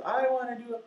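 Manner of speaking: high-pitched imitated eager child; eager extroverted kid. Kid raising his hand to volunteer, eager and high. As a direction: pipe up like a keen volunteer. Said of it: "some kid that loves to be extroverted".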